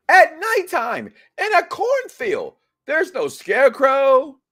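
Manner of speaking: slow, high, loud spooked words; spooked emphasis. Real, not acted. Host spooked, telling the co-host about the night cornfield. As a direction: stretch each phrase out in horror.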